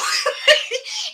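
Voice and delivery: high breathy burst of laughter; exasperated laugh. Real, not acted. Retelling the math teacher story, exasperated laugh. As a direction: laugh it off in disbelief. Laughs at her own frustration.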